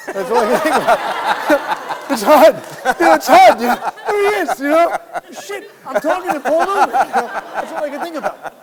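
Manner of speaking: laughing high and loud through the words; nervous laughter. Real, not acted. Telling the host about meeting Paul Newman, laughing nervously. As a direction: giggle it out, starstruck and nervous.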